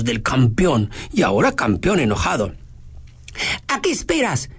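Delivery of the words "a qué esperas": shouting. Acted. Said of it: "gritó Adrián".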